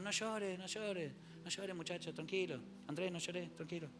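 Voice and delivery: soft, hushed consoling of a crying friend; calm them down kindly; gentle comforting. Retold or imitated. Jesus comforting the weeping disciples.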